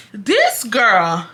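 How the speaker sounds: normal talking voice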